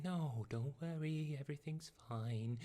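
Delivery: slow, soft, gentle mother voice; soothing mom. Retold or imitated. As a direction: lull them like a calm mom. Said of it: "and then Mom comes in".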